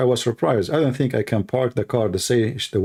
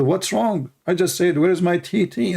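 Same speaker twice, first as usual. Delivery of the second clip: higher, puzzled protest; 7 semitones higher than usual. Retold or imitated. The speaker protesting to the office girls, higher and puzzled. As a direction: ask what's wrong, confused. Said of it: "I said".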